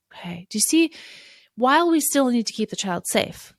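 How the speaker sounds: normal talking voice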